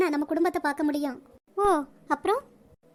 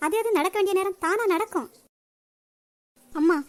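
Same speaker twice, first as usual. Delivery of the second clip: normal talking voice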